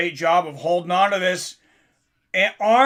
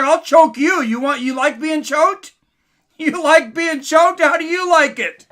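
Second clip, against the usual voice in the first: higher, louder mocking taunt imitating the fighter; mock furious taunt; 8 semitones higher, 5 dB louder than usual. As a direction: throw the taunt back at him, hammed-up. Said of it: "he's like".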